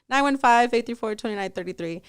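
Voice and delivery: phone number rattled off; too fast. Real, not acted. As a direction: reel the digits off in one hurried run. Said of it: "You said that too fast".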